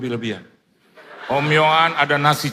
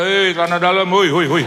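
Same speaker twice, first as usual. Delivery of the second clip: louder teasing shout across the hall; mock yell; 7 dB louder than usual. Pastor pretending to yell at Michael on stage, mock shout. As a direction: call out to get his attention. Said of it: "Saya mau teriak".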